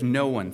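"No one" punched out loud; loud, emphatic stress on "No one". Shown as a demonstration. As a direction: hit "No one" hard, well above normal volume. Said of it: "emphatic. It's emphasizing".

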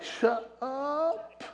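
soft, high, stretched "up" rising in amazement; stunned, half-whispered "no way"; disbelief. Retold or imitated. Preacher reacting to the stranger's word, softly amazed and high. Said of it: "And I'm like".

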